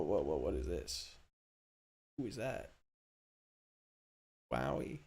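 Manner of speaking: usual speaking voice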